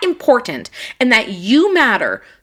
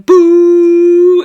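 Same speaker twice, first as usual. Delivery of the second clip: loud, high jeering boo; mock booing; 9 semitones higher, 12 dB louder than usual. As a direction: boo the rude driver like a crowd. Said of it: "they start going".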